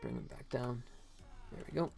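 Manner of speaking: ordinary delivery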